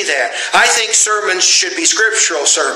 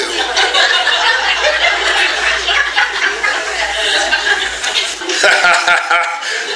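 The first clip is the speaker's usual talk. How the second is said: high breathy laughter; 6 semitones higher than usual. Real, not acted. Laughs at his own joke.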